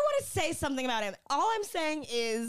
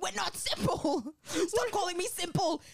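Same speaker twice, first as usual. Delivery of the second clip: mildly raised, higher mock protest; retold indignant protest; 5 semitones higher than usual. Host voicing a character in a quarrel, mock indignant. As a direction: act out a touchy objection.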